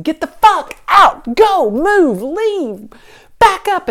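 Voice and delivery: loud and high, barking orders at a stranger; retold furious shout. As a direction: yell him out of the driveway in a panic. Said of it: "I think I had the devil voice".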